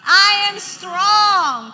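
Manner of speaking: very loud, high, stretched-out chant; bold declaration. Shown as a demonstration. Preacher leading the congregation to say it, loud and bold. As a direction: lead the room at full volume. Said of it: "What do the weak say?".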